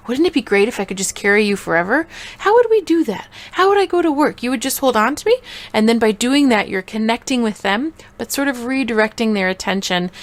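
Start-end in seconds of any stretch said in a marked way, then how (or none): 0.1-5.4 high, hammed-up excited questions; acted amazement, pitched up; playful wonder. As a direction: play along with a child's wild idea. Mom playing along with child's fantasy.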